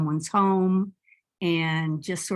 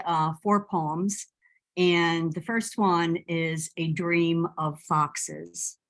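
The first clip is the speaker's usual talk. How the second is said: ordinary talk, nothing marked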